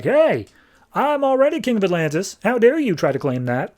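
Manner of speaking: high, indignant king's voice, pitched well up; outraged dead king. Retold or imitated. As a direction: play the offended undead king, pushing up high.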